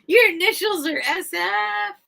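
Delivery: high, incredulous What; mock astonished coworkers; amazed question. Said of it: "They're like".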